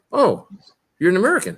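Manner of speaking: imitated realization, pitched well up; mock surprise. People hearing him open his mouth, mock surprised. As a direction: play the stranger suddenly catching on, high voice.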